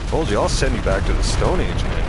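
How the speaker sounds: shouted threat, every word hit hard; loud threat. Real, not acted. Streamer shouting at the player he just killed, loud threat. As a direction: bellow the threat after the kill.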